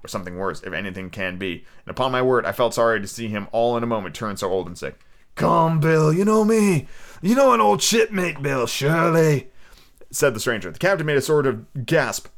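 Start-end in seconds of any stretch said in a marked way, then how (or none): 5.3-9.5 put-on higher, slow old sea dog; sly pirate. Stranger toasting his mate Bill, slow pirate voice. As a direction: drawl it like a scheming sailor.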